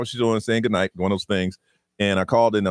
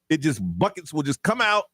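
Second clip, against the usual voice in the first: ordinary talk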